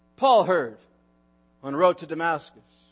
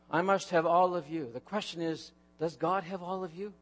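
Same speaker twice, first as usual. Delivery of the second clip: much softer and lower, pressing the point; hushed solemn question; 7 semitones lower, 11 dB quieter than usual. Real, not acted. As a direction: ask it almost in a murmur.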